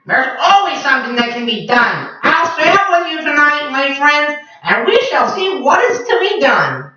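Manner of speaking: high, confident parrot promise; rally his friends; brave parrot. Acted. Papagayo reassures them.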